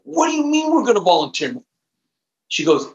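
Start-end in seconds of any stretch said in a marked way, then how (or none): 0.0-1.6 much higher, stunned retort; incredulous disbelief. Retold or imitated. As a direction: react as if she has lost her mind. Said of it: "I thought my wife had gone off her rocker".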